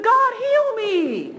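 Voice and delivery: high, loud, drawn-out plea; crying out. Retold or imitated. Preacher as the afflicted apostle begging God, crying out. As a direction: cry out slowly and high for healing. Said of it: "he's dealing with an infirmity".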